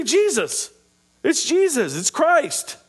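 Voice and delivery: put-on high, eager child's answer; a light child voice, eager to answer; kids answering. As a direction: chirp it like a kid who knows. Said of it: "They would say".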